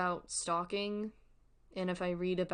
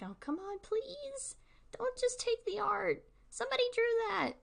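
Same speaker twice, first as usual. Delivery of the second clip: high, pleading mock whine; exasperated plea. Streamer begging fans to credit the artist, high exasperated plea. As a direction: beg them like you've had enough. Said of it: "It's just like".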